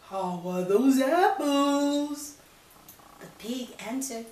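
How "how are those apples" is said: slow, loud sly wolf; a hint of sly wolf. Wolf asks.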